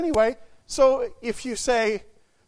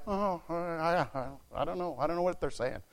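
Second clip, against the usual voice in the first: mock bafflement; 4 semitones lower, 8 dB quieter than usual. Voicing someone baffled by German talk.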